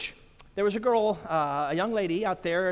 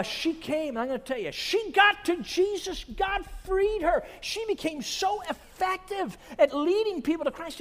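impassioned preaching; 12 semitones higher, 5 dB louder than usual. Acted. Preacher tells how God freed her, impassioned preaching. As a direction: proclaim each phrase high and stretched, triumphantly.

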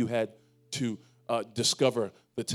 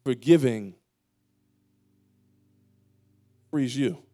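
louder, slow, emphatic preaching; forceful preaching; 3 semitones higher, 6 dB louder, slower than usual. Acted. Pastor hammering home forgiveness to the church, loud and slow. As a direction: drive each word home to the congregation.

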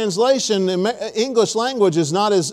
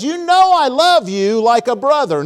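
higher, louder retold avowal; insistent answer; 4 semitones higher, 5 dB louder than usual. Preacher voices Peter answering Jesus, insistent. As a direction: insist on it, swelling on KNOW and LOVE.

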